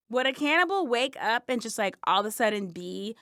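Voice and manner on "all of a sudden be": ordinary talk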